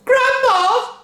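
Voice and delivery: high, loud shriek. A narrator's reading. Wife shrieking to her mother, loud and high. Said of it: "shrieked Missus Cranky".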